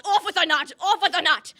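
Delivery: loud, high repeated order; shouted command. A narrator's reading. Prince ordering Cinderella's execution, shouted command.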